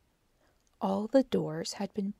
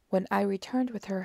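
plain reading voice

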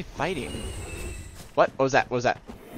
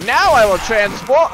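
loud, mock-dramatic proclamation; transforming enemy; 5 semitones higher, 6 dB louder than usual. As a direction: announce it like a hammy game enemy. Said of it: "He's like".

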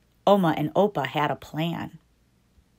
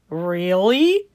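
daddy asking; 4 dB louder than usual. Acted. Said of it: "asked Trixie's daddy".

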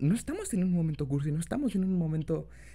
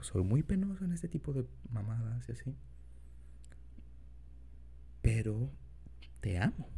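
put-on soft, low boyfriend voice; tender, shy lover, quiet; incubus; 6 semitones lower, 6 dB quieter than usual. Confession to partner in role.

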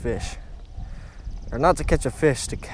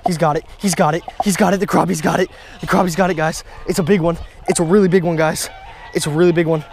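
loud, raised, urgent repeated calls; real excitement; 3 semitones higher, 4 dB louder than usual. Real, not acted. Angler tells viewers the crappie is hooked, real excitement. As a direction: blurt it out in a rush of excitement. Big crappie takes the fly.

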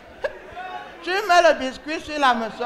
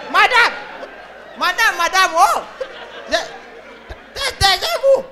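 exasperated shout; 6 semitones higher, slower than usual. Acted. Operator silences caller.

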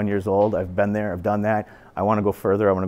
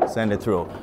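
ordinary delivery

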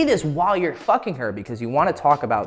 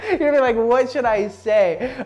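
high, loud laughing speech; giggly, raised voice; talking through laughter; 11 semitones higher, 4 dB louder than usual. Real, not acted. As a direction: push the words out while cracking up. Still laughing at botched example.

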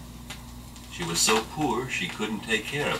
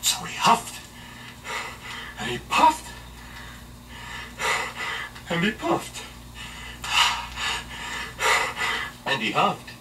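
dramatic narration; 12 semitones higher than usual. A narrator's reading. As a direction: build the effort with pauses.

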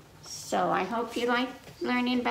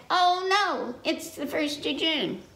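worried goat; 7 semitones higher than usual. Acted. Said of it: "goat said".

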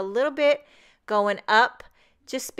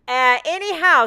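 breezy anyhow; 6 semitones higher, 8 dB louder than usual. Real, not acted. Snapping back after a tangent.

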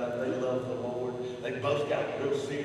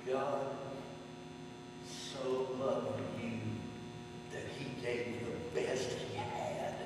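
hushed; 8 dB quieter, slower than usual. Real, not acted. Quiet tender gospel point.

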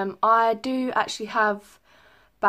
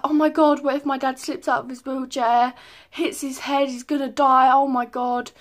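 panicked; 5 semitones higher, 6 dB louder than usual. Retold or imitated. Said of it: "so I was like".

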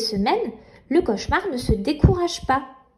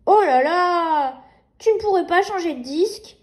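put-on little girl voice; 4 semitones higher than usual. Nina speaking to baby.

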